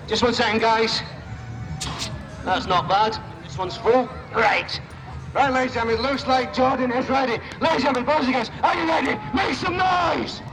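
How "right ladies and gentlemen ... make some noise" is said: hype-up announcer. Real, not acted. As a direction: whip the crowd up to a roar. Stunt show hype-up to crowd.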